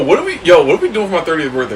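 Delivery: ordinary talk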